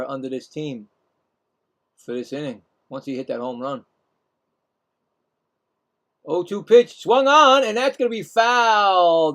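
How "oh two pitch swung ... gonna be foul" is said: excited call. Real, not acted. Streamer calling the pitch on the swing, excited. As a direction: voice jumps up and louder on the swing.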